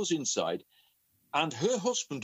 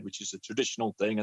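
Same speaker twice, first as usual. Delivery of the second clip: ordinary talk, nothing marked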